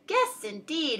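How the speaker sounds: disapproving friend; frowning retort. A narrator's reading. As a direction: throw the word back indignantly. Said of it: "his friend answered, and all of them frowned".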